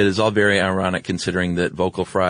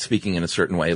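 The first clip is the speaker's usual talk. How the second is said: ordinary delivery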